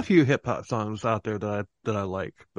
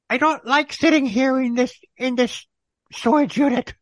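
high, loud mock grumbling; put-on high voice, loudly griping; mock complainer; 11 semitones higher, 5 dB louder than usual. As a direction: ham up a high-pitched fussy complaint. Imitating kidnapped Marge complaining.

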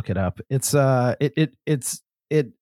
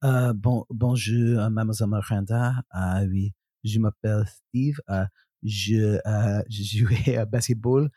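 hammed-up French voice; French accent; 4 semitones lower than usual. Shown as a demonstration. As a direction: speak schoolboy French like a native. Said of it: "Steve talking French".

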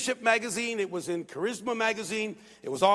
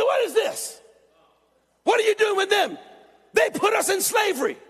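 high-pitched, raised angry outburst, retold; 9 semitones higher, 4 dB louder than usual. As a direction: imitate an indignant, accusing young man. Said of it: "He's angry".

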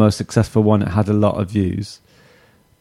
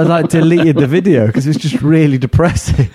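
normal talking voice

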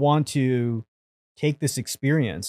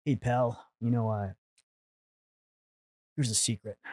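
soft reassuring mentor; 2 semitones lower, 9 dB quieter than usual. Retold or imitated. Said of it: "And he's like".

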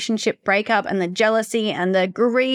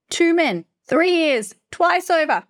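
exasperated listing; 9 semitones higher, slower than usual. Real, not acted. Exasperated listing of repeated pattern.

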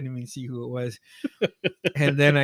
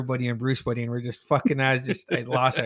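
ordinary talk, nothing marked

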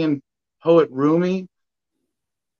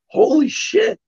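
awed exclamation; 13 semitones higher, slower than usual. Real, not acted. Awed realization of consciousness.

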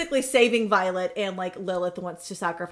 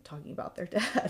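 ordinary delivery

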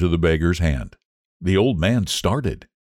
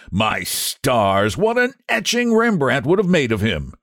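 high, sweeping theatrical exclamations; exclaimed shout; 9 semitones higher than usual. A narrator's reading. Painter raving to his friend about the beggar, high exclaiming. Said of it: "shouted Trevor at the top of his voice".

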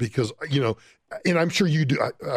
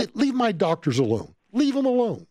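indignant demand; 7 semitones higher than usual. Retold or imitated. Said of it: "And I'm like".

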